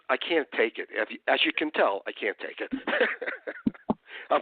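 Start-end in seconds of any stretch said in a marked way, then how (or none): 2.8-4.3 short laugh. Real, not acted. Laughs after his rant.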